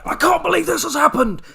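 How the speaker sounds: high, loud, gushing intro shout; over-the-top excited. Retold or imitated. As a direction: burst in like a hyped-up host. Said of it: "when you get Martin going".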